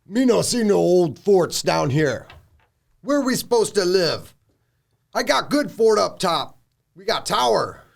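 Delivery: high, loud orc chief voice; annoyed orc chief. Acted. Orc chief complaining to the party about forts, annoyed. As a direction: grumble loudly in broken orc speech, annoyed. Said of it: "the chief's getting kind of annoyed".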